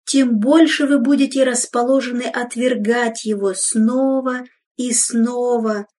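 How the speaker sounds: usual speaking voice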